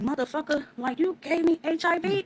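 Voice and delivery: high, furious yell into the phone; angry yelling. Retold or imitated. To her boyfriend on the phone, furious high yell. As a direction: let him have it, outraged and high. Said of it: "I was so loud up in there".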